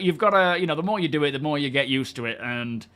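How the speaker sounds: ordinary talk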